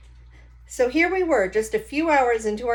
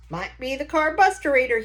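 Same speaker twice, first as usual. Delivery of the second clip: put-on higher, louder folksy old grandpa; old Gramps voice; 6 semitones higher, 6 dB louder than usual. Grandpa poking at a stranger's engine, confident folksy voice. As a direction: play the know-it-all grandpa tinkering.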